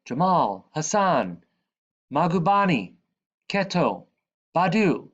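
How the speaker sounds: calling friends out to play. A narrator's reading. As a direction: call each friend's name loudly. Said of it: "I call for my friends".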